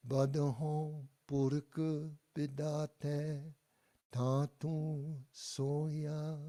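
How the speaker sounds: whole line Badahon purik bidate Tantu soya chanted slowly and clearly; slow teacher's lead for group repetition; slow model line. Shown as a demonstration. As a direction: lead the class through the line, slow and even. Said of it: "And now all together, the whole line".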